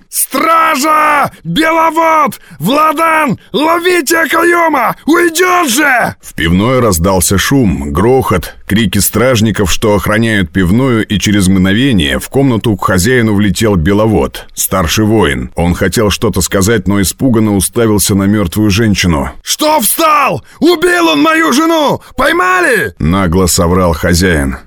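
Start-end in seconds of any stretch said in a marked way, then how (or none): 0.1-6.2 very high, drawn-out alarm shouts; yelling full throat. A narrator's reading. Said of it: "завопил во всю глотку". 19.4-22.9 very high, urgent shouted outcry; frantic yelling. A narrator's reading. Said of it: "завопил во всю глотку".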